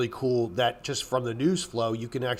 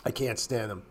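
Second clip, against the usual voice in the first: softer, lower muttered repeat; quiet repeat; 4 semitones lower, 6 dB quieter than usual. Real, not acted. Repeating his dislike of chart painters, softer and lower. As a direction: drop the voice and say it again to yourself. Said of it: "I, I can't stand them".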